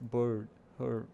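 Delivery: BIRD and HER with an "er" in the first syllable; one stressed "er" in BIRD, then HER; stressed R-vowel. Shown as a demonstration. Teacher contrasting R position, stressed R-vowel. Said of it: "Agora o R tá no começo".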